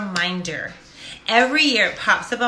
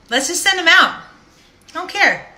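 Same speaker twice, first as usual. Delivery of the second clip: higher and louder, throwing caution away; reckless loud; 8 semitones higher, 6 dB louder than usual. Retold or imitated. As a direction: shout it like you've given up caring. Deciding to send cards anyway.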